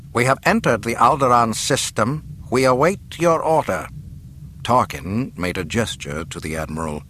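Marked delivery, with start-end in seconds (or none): 0.1-3.9 crisp officer's report, higher and raised; announcing officer. A narrator's reading. Admiral reporting to his governor, formal announcement. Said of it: "Motti announced".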